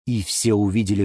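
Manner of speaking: usual speaking voice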